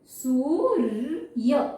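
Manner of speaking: सूर्य drawn out very slowly; slow demo. Shown as a demonstration. Teacher repeats सूर्य slowly for pupils. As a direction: pull सूर्य apart slowly, र before य. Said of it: "ऐसे ही लिखा जाएगा शब्द में".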